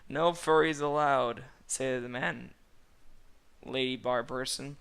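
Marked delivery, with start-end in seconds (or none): 0.1-1.5 shouted rule, louder and higher; a brassy bartender announcing. Acted. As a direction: bark the house rule. Bartender calls out loudly.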